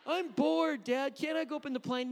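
high, loud imitated child complaint; bored kid. Bored child begging dad at the museum, complaining.

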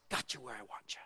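low, quiet aside as a scheming tempter; hushed, low mock gloating; the devil. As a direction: drop low and soft, imitating a satisfied devil. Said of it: "and the devil doesn't mind".

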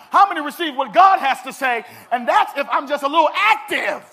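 loud, driving pulpit voice with a laugh at the end; preacher rousing the church, loud and building; full-force preaching. Acted. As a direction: hammer it home to the congregation.

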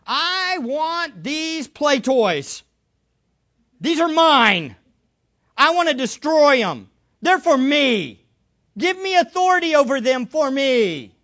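loud, possessive demand as Satan. Acted. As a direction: claim them greedily for yourself, hitting each phrase hard. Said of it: "it's as if Satan is asking for himself".

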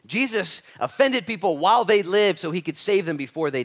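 impassioned pulpit delivery; full-force preaching. Real, not acted. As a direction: drive the point home loudly.

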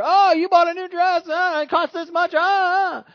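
very loud, high mock outrage; hammed-up shrieking complaint from an aggrieved husband; throwing it in her face. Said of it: "throw it up in her face later".

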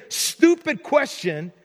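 stupid question stretched slow; slowly stressing stupid question; emphasis. Real, not acted. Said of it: "dare I say".

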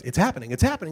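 rising, excited announcer; mock announcer building up. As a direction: let the tension climb. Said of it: "the announcer goes".

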